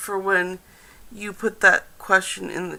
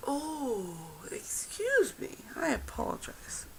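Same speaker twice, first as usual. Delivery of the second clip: whisper; 3 semitones higher than usual. Real, not acted. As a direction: keep it hushed and breathy. Whispered apology and question.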